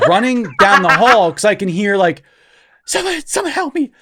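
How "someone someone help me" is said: high, softer strained plea; whisper scream. Retold or imitated. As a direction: imitate Dad's hushed cry for help. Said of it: "I think I hear some whispering".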